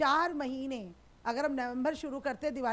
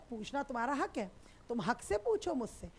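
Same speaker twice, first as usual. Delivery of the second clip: usual speaking voice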